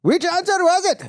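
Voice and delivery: high-pitched, hammed-up impression; Frank Azaria. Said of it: "That was a Frank Azaria impression".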